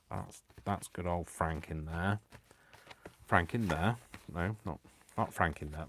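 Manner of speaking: normal talking voice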